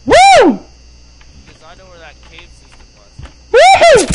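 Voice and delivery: loud, high-pitched "woo"; high whoop. Real, not acted. Gamer reacting to the level, high excited whoop. Cheering while playing the game.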